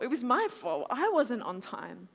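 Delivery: normal talking voice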